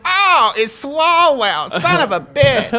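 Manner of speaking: high, drawn-out comic groaning quote; hammed-up disgusted reaction; mock dismay. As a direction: play exaggerated letdown, slow and high. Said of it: "and they're like".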